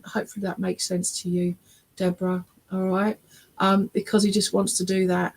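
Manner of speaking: ordinary talk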